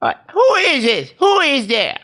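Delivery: put-on high, quavering old lady at the intercom; grandma voice. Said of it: "and she's like".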